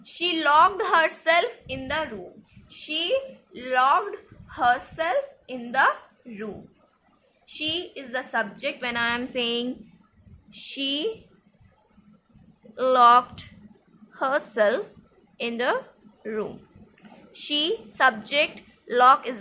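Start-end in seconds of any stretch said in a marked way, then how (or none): 2.7-6.7 slow dictation with a break between words. Shown as a demonstration. Teacher repeating an example sentence for learners, slow word-by-word. 10.5-16.6 very slow, long pauses between chunks; word-by-word dictation. Shown as a demonstration. Teacher breaking down an example for grammar students, word-by-word.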